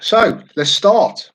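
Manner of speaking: quiz host kickoff. Real, not acted. Host tells players the quiz begins, drawn-out announcement.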